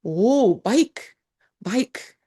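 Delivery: baik sung out high and rising; cheerful local reply. Retold or imitated. A local happily answers the tourist in high rising tones. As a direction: answer brightly, lifting each baik. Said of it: "Apa kabar".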